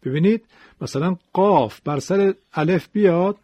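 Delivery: ordinary talk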